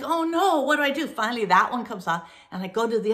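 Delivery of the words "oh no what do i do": panic. Retold or imitated. Panicking mid-haircut.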